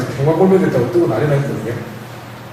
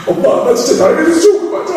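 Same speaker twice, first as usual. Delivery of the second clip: put-on much higher, wailing little boy; retold crying child; 13 semitones higher than usual. Crying boy asking his mother, high wail. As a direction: wail it like a sobbing kid. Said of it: "울기 시작해가지고".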